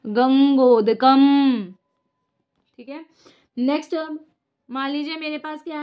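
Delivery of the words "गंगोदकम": drawn-out answer word; slow stretched answer; slow answer reveal. Shown as a demonstration. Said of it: "तो क्या बन जाएगा".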